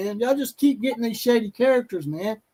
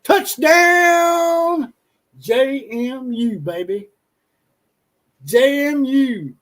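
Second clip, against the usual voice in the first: high, loud announcer whoop, then drawn out slowly; hyped play-by-play yell, then a slow chant of the team name; mock touchdown call; 4 semitones higher, 4 dB louder, much slower than usual. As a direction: call it like a stadium announcer going wild.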